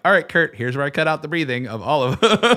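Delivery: playful mock instruction. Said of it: "I'm like".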